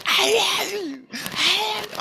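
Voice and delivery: drawn-out, piercing mock scream; very high, loud imitation of a shrieking priestess. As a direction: screech it slowly at full pitch. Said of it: "she's screaming in my fucking head".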